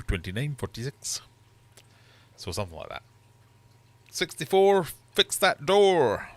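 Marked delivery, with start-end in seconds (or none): 4.1-6.3 high, loud, slow bingo call; bingo caller. Acted. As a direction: belt out the bingo rhyme.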